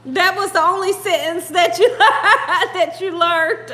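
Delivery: real laughter breaking into the sentence; laughing. Real, not acted. Streamer teasing a viewer about the only sentence learned, laughing. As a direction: crack up midway through and keep talking.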